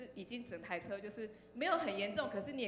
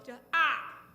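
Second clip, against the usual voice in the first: sudden loud yelp at the crash; shocked shout at impact; startled cry; 5 semitones higher, 12 dB louder than usual. Retold or imitated. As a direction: blurt out a sharp "ah".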